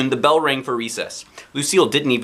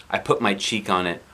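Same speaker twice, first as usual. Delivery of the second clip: plain reading voice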